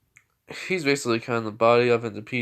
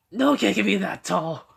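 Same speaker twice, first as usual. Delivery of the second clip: high, loud incredulous objection; loud disbelief; 8 semitones higher, 7 dB louder than usual. Retold or imitated. Character doubts a tall boy's age, loudly incredulous. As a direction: exclaim it as if you can't believe it. Acted disbelief at the kid's height.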